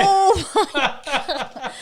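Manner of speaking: high, laughing "oh my God"; laughing disbelief. Real, not acted. Reacts to beer and hot dog.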